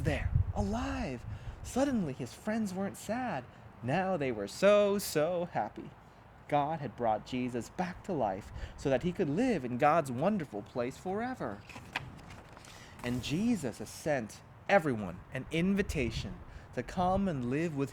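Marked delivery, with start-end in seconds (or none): none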